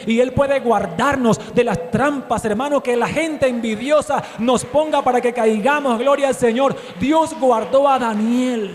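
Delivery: loud fervent sermon delivery; full-force preaching. Acted. Preacher exhorting the congregation, loud and fervent.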